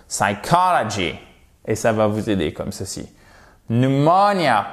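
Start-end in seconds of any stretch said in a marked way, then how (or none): 0.1-1.1 "Psychology" as "sai-KAH-la-gee"; whole word, stress on COL. Shown as a demonstration. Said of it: "pour faire tout le mot". 3.7-4.7 "Pneumonia" slowly, as "nu-MO-nia"; silent P. Shown as a demonstration. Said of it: "si vous mettez le P".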